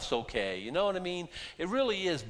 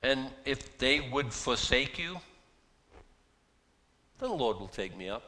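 solemn reread. Real, not acted. Verse repeated with weight.

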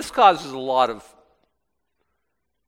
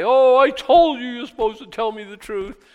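mocking Ahab; 9 semitones higher, 7 dB louder than usual. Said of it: "and now you're telling this guy".

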